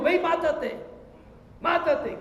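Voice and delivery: insistent devil egging him on; devil goading. Acted. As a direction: push him toward death like a tempter. Devil urging man to kill himself.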